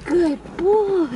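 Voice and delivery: high, sing-song praise for the dog. Real, not acted.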